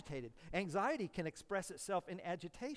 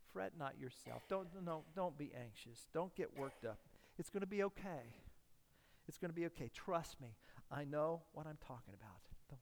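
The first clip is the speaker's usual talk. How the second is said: soft, low reassuring murmur; calm, confident grandfather; 6 semitones lower, 9 dB quieter than usual. Retold or imitated. A seasoned royal grandfather calming an anxious listener, gently. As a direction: soothe them like a wise old king. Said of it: "calmly and confidently".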